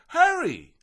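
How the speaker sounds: hurry. A narrator's reading. Butterfly urging him to flee.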